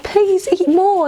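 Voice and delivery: high, slow nan pleading; pushy nan. Retold or imitated. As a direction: coax like a doting nan. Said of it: "They're like".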